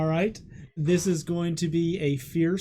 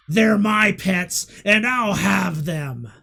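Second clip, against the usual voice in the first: slow, loud monstrous threat; growling beast; 3 semitones higher, 9 dB louder, slower than usual. Acted. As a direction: claim the pets like a huge beast. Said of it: "grows into an eleven-foot-tall beast".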